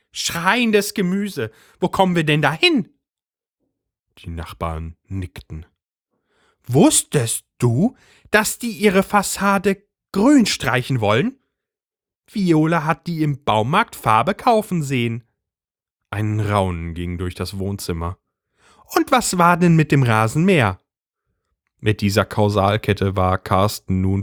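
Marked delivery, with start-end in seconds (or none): 0.1-2.9 mock the excuse with indignation; pitched-up indignant housewife; scoffing wife. A narrator's reading. Brigitte scoffs. 6.7-15.2 higher, excited gossiping wife; high agitated housewife voice; Brigitte's voice. A narrator's reading. 19.0-20.8 higher, excited gossiping wife; high agitated housewife voice; Brigitte's voice. A narrator's reading. Brigitte presses.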